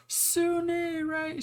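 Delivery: high-pitched retold scream of his name. Josie on the floor screaming for Sune, imitated high shriek. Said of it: "She's screaming on the floor".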